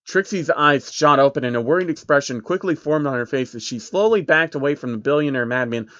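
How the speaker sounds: plain reading voice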